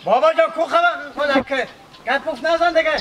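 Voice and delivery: insistent, scolding command; impatient demand. Acted. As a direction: cut off the argument and order him loudly. Impatiently demanding the sheep be handed over.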